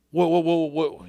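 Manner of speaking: mock alarm, words tumbling out in repeats; startled. Golfer grabbed tight by his mentor, startled.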